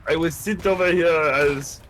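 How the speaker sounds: stiff put-on Austrian accent; a soldier reporting, hammed up; mock Austrian accent. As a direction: fake Austrian recruit voice.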